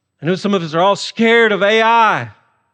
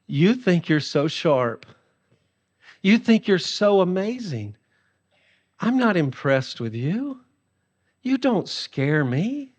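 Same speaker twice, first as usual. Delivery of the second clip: quiet mocking scorn; mocking God; 5 dB quieter than usual. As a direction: belittle them coolly from above. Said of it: "God is bent over in laughter".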